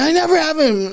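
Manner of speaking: high-pitched mock whine; complaining. Older kid grumbling to mom about money, complaining. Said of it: "I was, like, complaining".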